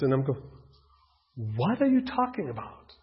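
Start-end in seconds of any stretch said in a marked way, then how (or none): 1.6-2.9 high, startled retold question; puzzled reaction. A boy reacting to Jim's talk of Jesus, high and baffled. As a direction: act baffled, like a kid hearing something strange.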